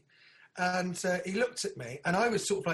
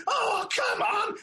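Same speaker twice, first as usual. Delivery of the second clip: mock whiny plea; 4 dB louder, much slower than usual. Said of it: "he was like".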